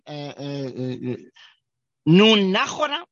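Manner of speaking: normal talking voice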